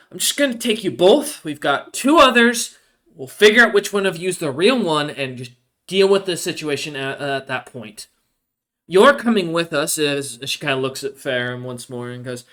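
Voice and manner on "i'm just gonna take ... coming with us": a hint of a louder, higher commanding paladin; lightly voiced no-nonsense paladin; stern paladin captain. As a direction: lay down the law to the prisoners. GM voicing paladin Rilvi.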